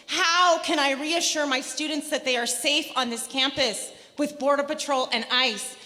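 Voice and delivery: normal talking voice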